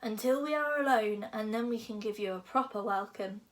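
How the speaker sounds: plain reading voice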